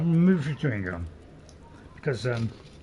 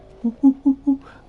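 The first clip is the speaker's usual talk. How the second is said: monkey noise; 14 semitones higher, 11 dB louder than usual. Pretend. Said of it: "don't get me onto the animal noises".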